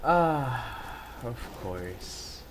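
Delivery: ordinary talk, nothing marked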